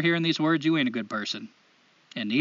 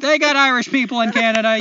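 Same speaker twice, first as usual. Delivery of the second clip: loud, higher, hammed-up Canadian-Scottish brogue; Canadian accent drifting Scottish; 5 semitones higher, 6 dB louder, faster than usual. Host defending a slipping accent to co-host, exaggerated Canadian-Scottish accent. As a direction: defend your wandering Canadian accent, loudly. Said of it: "starting to sound like that Scottish guy".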